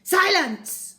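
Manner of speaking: loud, high teacher shout; shouted order. Acted. Miss Midge shouts the class down, loud and high. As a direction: bellow it to silence the room. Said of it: "Miss Midge yelled at them".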